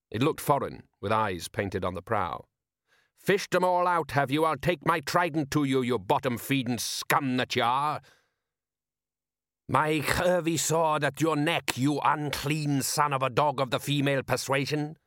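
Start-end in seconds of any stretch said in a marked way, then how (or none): 3.2-8.0 raised-pitch fisherman's tirade; irate father threatening a thief; angry threat. Acted. Trading threats with rival fisherman. 9.7-15.0 florid foreign rival. Acted. Rival answers with florid threat.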